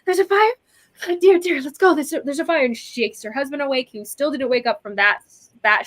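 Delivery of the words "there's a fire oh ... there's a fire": much higher, flustered and alarmed; a lightly voiced flustered old wife; startled woman. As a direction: jolt awake in a panicked fluster. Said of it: "They're like".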